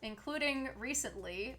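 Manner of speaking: ordinary talk, nothing marked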